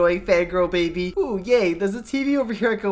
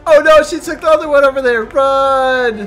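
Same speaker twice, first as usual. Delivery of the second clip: loud, fast alarm; urgent, high-energy panic; panicked yell; 4 semitones higher, 7 dB louder than usual. Acted. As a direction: yell a warning and bolt. Fleeing the fangirl in game.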